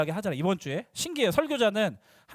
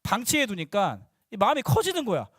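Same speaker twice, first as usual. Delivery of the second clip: ordinary talk, nothing marked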